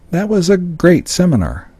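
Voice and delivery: high-pitched but half-hearted praise; faint, insincere compliment; doubtful inflection. Shown as a demonstration. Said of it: "but the inflection".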